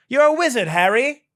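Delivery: high, loud mock Hagrid line; Hagrid quote. Quoting Harry Potter to mock the video, high and loud. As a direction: announce it like a wizard reveal. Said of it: "Harry quote".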